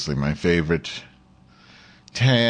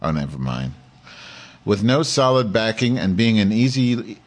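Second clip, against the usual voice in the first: ordinary talk, nothing marked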